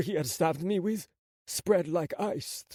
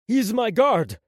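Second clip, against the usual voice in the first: high, loud insistence; urgent protest; 6 semitones higher, 7 dB louder, slower than usual. Acted. Prince shielding his guard from gunman, urgent protest. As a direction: defend your man forcefully.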